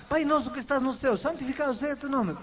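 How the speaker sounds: fast mechanical recitation of Pai nosso; rattled-off rote prayer. Shown as a demonstration. Pastor mocking rote prayer, rattled off fast. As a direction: rush the words like a memorized habit. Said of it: "isso não é orar".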